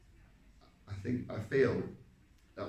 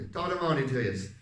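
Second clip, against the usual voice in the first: loud, higher stage-Irish greeting; Irish accent; 4 semitones higher, 7 dB louder than usual. Shown as a demonstration. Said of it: "I mean, the Irish accent".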